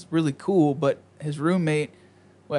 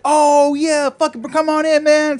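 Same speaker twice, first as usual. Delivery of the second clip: much higher and louder, suddenly friendly; hyped welcome; 11 semitones higher, 7 dB louder, slower than usual. Retold or imitated. Dealer suddenly remembers him and waves him in, an excited loud welcome. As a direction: switch from suspicious to all-in buddy.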